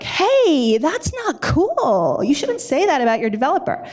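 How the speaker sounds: high, airy woo-woo voice, stretching out the scolding; woo-woo inner voice. Retold or imitated. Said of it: "the woo-woo in me was like".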